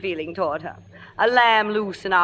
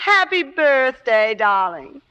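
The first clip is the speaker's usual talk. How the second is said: reluctant; 5 semitones higher, 5 dB louder, slower than usual. Acted. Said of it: "the reading could have been better".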